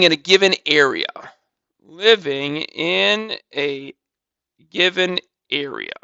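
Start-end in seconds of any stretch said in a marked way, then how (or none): 1.8-5.9 slowed down word by word, a bit quieter; slow dictation. Shown as a demonstration. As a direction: spell it out slowly so students can copy "Living in a given area". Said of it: "I'll underline the word area".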